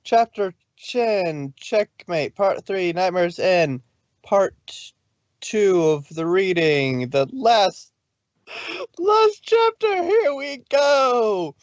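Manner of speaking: high, slow excited intro; bright chapter announcer. Acted. Narrator opening the reading to listeners, excited. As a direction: announce the chapter like a show opener.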